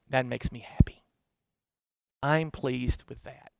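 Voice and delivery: soft whisper. Retold or imitated. Jesus smiling at a gift of love, soft whisper. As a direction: hush it as a pleased secret.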